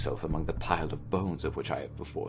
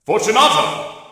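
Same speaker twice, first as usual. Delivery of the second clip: much louder call of the name; calling again; 14 dB louder than usual. Acted. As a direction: shout his name once more, impatient. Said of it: "I called again".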